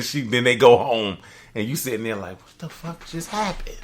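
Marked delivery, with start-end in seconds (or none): 2.4-3.8 mock dazed disbelief. Stunned man afterwards.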